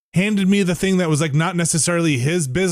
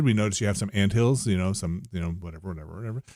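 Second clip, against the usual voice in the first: from low to much softer, trailing off; fading salesman; 8 semitones lower, 7 dB quieter, faster than usual. Retold or imitated. Mimicking a door-to-door pitch, fading to a soft mumble. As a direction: let the sales pitch fade away.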